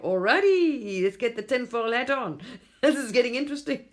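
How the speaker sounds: high, loud, amused sarcasm; laughing tinfoil-hat joke; mock excited aside. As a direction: play it up like a wide-eyed skeptic. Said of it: "I'm like".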